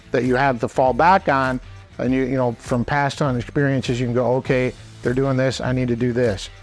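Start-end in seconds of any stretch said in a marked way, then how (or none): none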